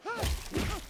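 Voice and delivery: short, high villain cackle; evil cackle. Acted. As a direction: cackle like a greedy monster. Villainous crab gloating over prey.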